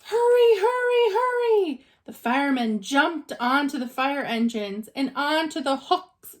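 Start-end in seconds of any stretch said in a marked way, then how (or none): 0.1-1.9 high, loud urgent call; rally the crew, hurry hurry; urgent shout. A narrator's reading. Firemen urge each other to the fire, high urgent shout.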